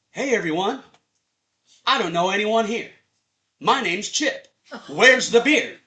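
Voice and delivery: booming, high, confident call to the room; loud party announcement. Retold or imitated. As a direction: announce yourself to the whole party. Party guy announcing himself loudly.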